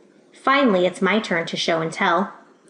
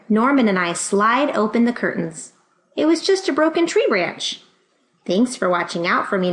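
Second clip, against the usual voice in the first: usual speaking voice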